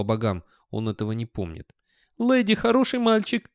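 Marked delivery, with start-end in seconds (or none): 2.2-3.5 very high-pitched, excited doggy voice; ecstatic dog. Acted. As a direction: squeal it like an overjoyed dog. Excited simple dog speaks of himself.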